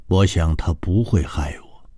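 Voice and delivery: ordinary delivery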